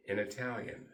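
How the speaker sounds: normal talking voice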